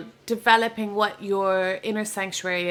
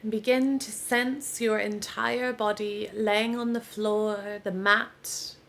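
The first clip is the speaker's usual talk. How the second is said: guided meditation; 3 dB quieter than usual. Real, not acted. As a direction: ease them into rest, unhurried, with long pauses. Leads a yoga nidra relaxation.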